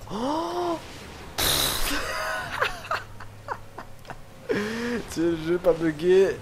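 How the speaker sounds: shrieking laugh of disbelief; laugh-cry through the panic; panicking gamer. Real, not acted. Gamer stuck in a glitch.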